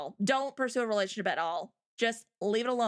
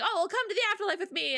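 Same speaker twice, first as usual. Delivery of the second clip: higher, fast mock invitation; lightly voiced ghost girl; 8 semitones higher, much faster than usual. Dead girl invites Inuyasha to afterlife, lightly voiced. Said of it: "so she's like".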